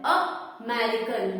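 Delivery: American stretched very slowly, each sound clear; teacher sounding out American at a crawl; slow sounded-out word. Shown as a demonstration. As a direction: draw out American slowly so the first vowel sound is heard. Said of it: "बोल के देखते हैं".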